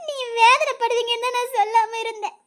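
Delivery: tearful, sobbing explanation; weeping apology to a husband; crying. Acted. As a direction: explain through sobs. Wife weeping as she explains herself.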